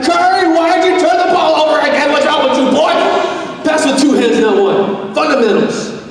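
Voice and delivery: high, loud, worked-up yelling at the game; screaming sports fan. Acted. Preacher acting out a guest yelling at Curry during the Warriors game, screaming. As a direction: holler at the player like an exasperated fan. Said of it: "You're screaming like".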